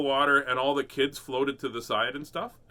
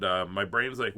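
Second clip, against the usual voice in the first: ordinary talk, nothing marked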